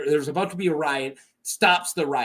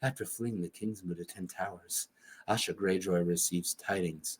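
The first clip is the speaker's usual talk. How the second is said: very low, hushed storyteller; ASMR romance reading; 11 semitones lower, 12 dB quieter than usual. Pretend. Reading like a romance novel, low and very soft ASMR voice. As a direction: murmur it like a steamy audiobook. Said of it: "like A S M R, like I'm reading".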